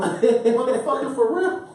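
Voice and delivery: high laughing squeal; cracking up at the absurd request; laughing disbelief. Real, not acted. Laughing, incredulous quote.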